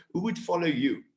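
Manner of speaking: ordinary delivery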